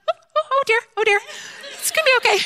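hammed-up motherly oh dear; comforting teacher. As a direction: soothe a sobbing friend in a sing-song coo. Teacher comforts crying mom.

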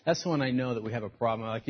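usual speaking voice

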